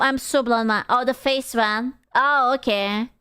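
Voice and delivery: ordinary delivery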